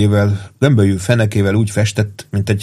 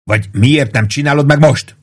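higher, heated demand; yelling; 6 semitones higher than usual. A narrator's reading. Pete demanding Pruitt for his platoon, yelling. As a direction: demand an answer in an agitated raised voice. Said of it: "üvöltötte Pitt".

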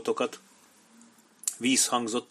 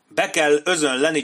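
loud, raised, forceful urging; insistent; 5 semitones higher, 7 dB louder than usual. A narrator's reading. Major argues for storming the entrance, insistent. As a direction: push the plan with force.

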